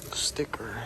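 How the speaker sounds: breathy words through cold fingers; blowing on cold hands. Real, not acted. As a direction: talk while warming your hands. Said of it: "I can't feel my fingers".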